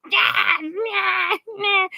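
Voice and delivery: high, cried-out nah; retold yelling. Yelling nah at her boyfriend on the walk, high and cried out. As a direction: yell it out in mock protest.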